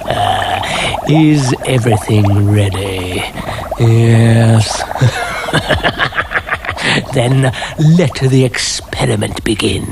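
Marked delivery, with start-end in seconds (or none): 4.9-7.1 unvoiced sinister laugh; gloating snicker; breathy evil laugh. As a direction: laugh like a mad scientist. Mad scientist laughs.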